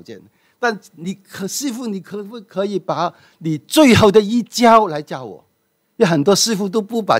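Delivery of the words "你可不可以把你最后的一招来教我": ordinary delivery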